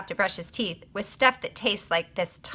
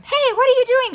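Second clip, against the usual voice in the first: loud, much higher demanding call; 7 dB louder than usual. A narrator's reading. Mary catches John in the bathroom, loud demanding. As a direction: sister bursts in and demands an answer. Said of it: "she demanded".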